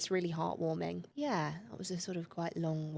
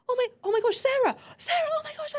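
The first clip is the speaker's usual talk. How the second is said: much higher, louder, delighted cry of her name; overjoyed dad; 6 dB louder, faster than usual. Retold or imitated. Dad recognising his daughter on the course, overjoyed cry. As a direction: burst with joy at seeing her. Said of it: "he was like".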